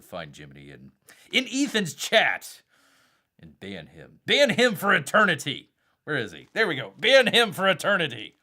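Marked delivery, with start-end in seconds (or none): 4.3-5.7 loud, high hammed-up decree; mock outrage. Mock feud with viewer Jiminy. 7.0-8.3 loud, high hammed-up decree; mock outrage. Mock feud with viewer Jiminy.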